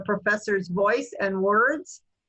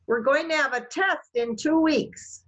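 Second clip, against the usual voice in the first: "test" lifted higher than the other words; higher pitch on test; 5 semitones higher than usual. Shown as a demonstration. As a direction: raise the pitch on "test" to grab attention. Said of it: "it's higher than I was saying".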